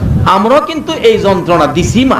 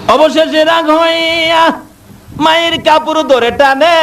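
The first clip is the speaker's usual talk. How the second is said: high, sung-out waz chant building to a shout; preaching at full force; 8 semitones higher than usual. Acted. Preacher to the congregation, rising sung waz chant. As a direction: lift the sermon into a wailing chant.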